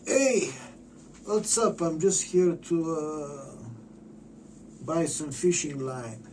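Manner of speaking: high, bright-eyed fake friendly greeting; goofy disguise voice. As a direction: play the harmless shopper in disguise. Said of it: "I guess under a jacket, it's like".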